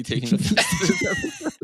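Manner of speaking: mock outrage. Mock offense in banter.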